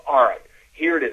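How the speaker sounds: loud, lively story opener; enthusiastic. Shown as a demonstration. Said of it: "have some enthusiasm".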